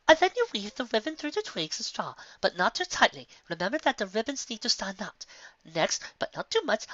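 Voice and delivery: high, prim instructing voice; high prissy teacher voice; Rarity lecturing. Acted. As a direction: lecture like a fussy fashion pony. Rarity instructing nest making.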